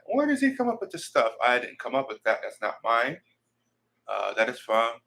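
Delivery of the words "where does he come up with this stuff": much higher, mock-wondering voice; imagined puzzled viewer. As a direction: imitate someone marveling in a high pitch. Voices puzzled viewers asking about him.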